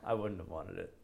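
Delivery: usual speaking voice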